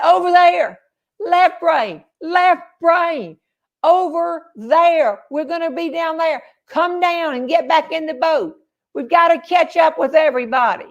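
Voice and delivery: high-pitched retold holler across a distance; calling out. Canoe group hailing their stranded friend up on the road, calling out.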